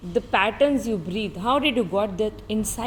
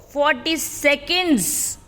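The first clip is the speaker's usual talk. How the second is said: normal talking voice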